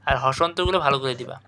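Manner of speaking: normal talking voice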